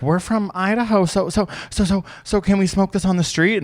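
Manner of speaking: high, eager mock-tourist voice; imitated tourist. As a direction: stumble eagerly into the question as a tourist. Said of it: "there's, like, a tourist being like".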